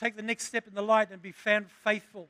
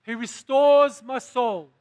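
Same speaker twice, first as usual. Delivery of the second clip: proclaiming; 3 semitones higher, 10 dB louder, slower than usual. Acted. Preacher proclaiming the psalm.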